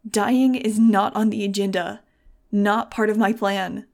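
slow, very loud, emphatic command; loud, slow, furious insistence; desperate yelling. A narrator's reading. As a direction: shout each word with force. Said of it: "I was yelling right into it".

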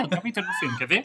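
ordinary talk

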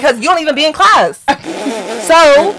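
high, loud scornful comeback; disrespectful retort. Retold or imitated. Telling off roommate who skips class, loud disrespect. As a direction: fire it back, hammering the point. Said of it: "I was low-key being disrespectful".

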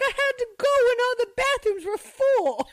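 put-on high child voice, matter-of-fact; little kid excuse. Boy explaining to the teacher, high and innocent. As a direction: pipe up like a second grader explaining. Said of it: "He's like".

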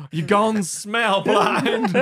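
high, loud, slow pronouncement; hammed-up grave diagnosis; mock melodrama. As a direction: draw it out like a tragic mother. Acts mama's melodramatic reply.

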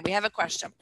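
usual speaking voice

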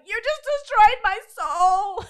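high, loud, overwrought lament; mock wounded wail. To the D person, a high dramatic wail. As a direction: wail it like a hammed-up tragedy. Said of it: "And I'm like".

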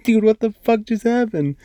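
high, stunned reenacted outburst; shocked disbelief. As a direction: replay your jaw-drop moment, way up high. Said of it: "I'm like".

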